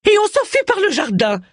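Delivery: cri très aigu et urgent. A narrator's reading. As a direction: crie d'une voix perchée, pour entraîner ton mari. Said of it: "cria Élisabeth". (cri aigu qui presse de fuir)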